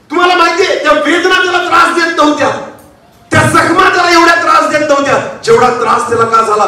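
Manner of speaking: high-pitched, insistent sermon delivery. Acted. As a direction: preach it high and sustained.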